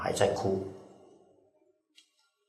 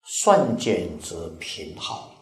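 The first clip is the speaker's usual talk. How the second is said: slow quoted recitation; 3 semitones lower, much slower than usual. Shown as a demonstration. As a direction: read the scripture line very slowly, word by word. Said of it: "就是前面讲的".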